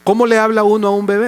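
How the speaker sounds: ordinary talk, nothing marked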